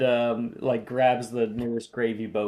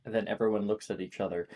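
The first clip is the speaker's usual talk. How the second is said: usual speaking voice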